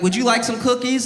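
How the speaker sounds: put-on high sweet little girl offering cookies. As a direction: lean in with a high, sugary kid voice.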